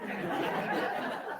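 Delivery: a short airy laugh; real breathy laugh. Real, not acted. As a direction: let a quick soft laugh out. Audience-style laugh after joke.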